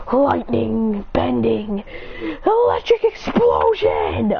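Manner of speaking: attack-name call. Acted. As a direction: announce your special move, building to the last words. Acted calling out an attack.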